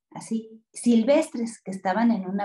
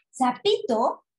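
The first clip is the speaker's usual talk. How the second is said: ordinary delivery, nothing marked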